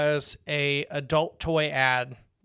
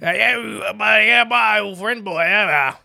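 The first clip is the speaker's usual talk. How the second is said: put-on Creole accent; 6 semitones higher, 7 dB louder, slower than usual. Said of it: "maybe except for Creole".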